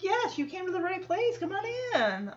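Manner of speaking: high, soft teacher voice; welcoming and sweet. Retold or imitated. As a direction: imitate a teacher sweetly ushering someone in. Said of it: "she's so welcoming and sweet".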